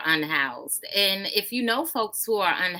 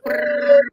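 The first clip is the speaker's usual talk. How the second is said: lip trill; 10 dB louder than usual. Real, not acted. Host makes a trilling noise.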